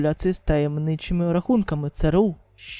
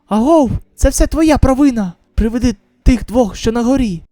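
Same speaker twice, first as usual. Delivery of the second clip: loud, high accusing yell; angry shout; 4 semitones higher, 4 dB louder than usual. Acted. A survivor yelling blame at the man as the spikes grow. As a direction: hurl the blame at him.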